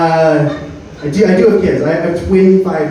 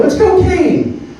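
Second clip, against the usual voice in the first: put-on much higher, slow, drawn-out childish answer; proud kid answer; much slower than usual. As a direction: sing it out like a smug little kid. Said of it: "And I go".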